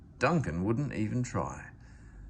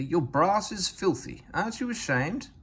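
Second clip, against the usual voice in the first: lightly voiced scolding girl; 7 semitones higher than usual. A narrator's reading. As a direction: shame him for his dirty brass. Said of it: "she said severely".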